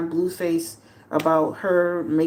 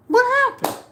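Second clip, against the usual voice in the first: much higher and louder, incredulous squeal; mock disbelief; 4 dB louder than usual. Real, not acted. Asking viewers what came of the blogger fight, incredulous squeal. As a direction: throw it out in disbelief. Rhetorical question repeated.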